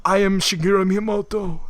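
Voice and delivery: put-on Japanese-accented introduction; Shigeru Miyamoto. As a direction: imitate halting Japanese-accented English. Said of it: "Japanese guy who can't speak English at all".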